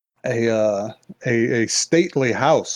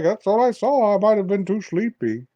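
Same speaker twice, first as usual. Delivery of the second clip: mock grandpa; 8 semitones higher than usual. Grandpa voiced in retold story.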